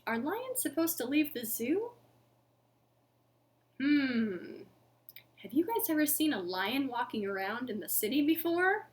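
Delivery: ordinary delivery, nothing marked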